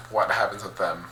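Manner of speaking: usual speaking voice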